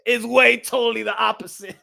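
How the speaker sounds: ordinary talk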